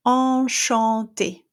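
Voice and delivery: enchanté slow, open nasal en, no N; French nasal. Shown as a demonstration. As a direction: drop jaw for enchanté. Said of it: "The first one I have here is".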